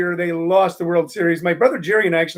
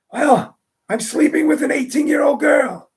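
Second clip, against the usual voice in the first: put-on boastful old man; 7 semitones higher than usual. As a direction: crow it out like a proud old man.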